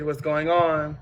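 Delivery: normal talking voice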